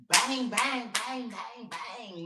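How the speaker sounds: shouted mock gunshots; loud bang bang. To the listener, shouting bang bang. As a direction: punch out each bang loudly.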